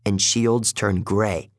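plain reading voice

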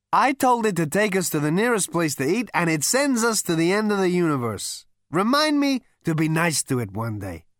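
high, loud, brash Zaphod; boastful high party voice. A narrator's reading.

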